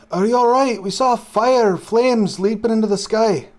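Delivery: loud, high-pitched barkeep calling out in alarm. Acted. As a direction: call out urgently, pitched well up. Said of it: "the barkeep says".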